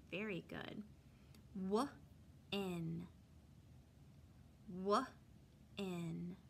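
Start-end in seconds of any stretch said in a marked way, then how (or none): 1.7-6.4 "W-in" split into onset and rhyme; sounding out words. Shown as a demonstration. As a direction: say "W-in" with a gap after the W.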